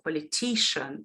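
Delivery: POLITICIAN with the weight on TI, the syllable before -cian; stress on third syllable. Shown as a demonstration. Teacher showing stress shift in a word family, politician stressed on TI. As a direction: land late in the word: poliTIcian. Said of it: "need to shift the stress".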